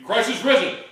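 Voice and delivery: loud, high proclamation; shouted greeting. Acted. Lone man facing the assembly after the atheism speech, shouted. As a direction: shout it out to a silent hall. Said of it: "he shouted the ancient Orthodox greeting".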